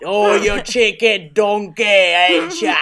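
slow, put-on Scottish accent; a playful attempt at a Scottish accent. Shown as a demonstration. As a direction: lilt it like a Highland local. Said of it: "That's not what they sound like".

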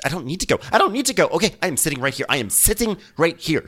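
high, insistent retold refusal; mock defiance. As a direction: imitate someone digging in during a fight. Acted refusal in a quarrel.

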